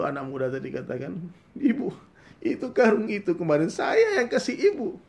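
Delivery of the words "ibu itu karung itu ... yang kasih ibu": much higher, louder astonished cry with a laugh; excited high cry. Acted. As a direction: shout in amazed recognition. Son recognises the rice sack.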